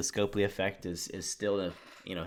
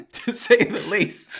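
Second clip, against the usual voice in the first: laughing; 11 semitones higher, 8 dB louder, slower than usual. Real, not acted. Laughing disbelief.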